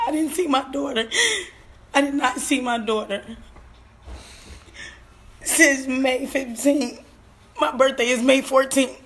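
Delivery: holding back tears; voice breaking. Real, not acted. Grieving mother on live, voice breaking.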